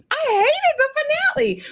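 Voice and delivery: high squealing groan of disgust; mock whine. Real, not acted. Host moaning to co-host about the finale, squealed high. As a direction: squeal out how much you hated it. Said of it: "I hated it".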